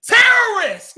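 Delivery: high, loud shouted accusation; imagined yell at a man in camo; mock yell. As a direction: yell it like an alarm. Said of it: "I really wanted to yell".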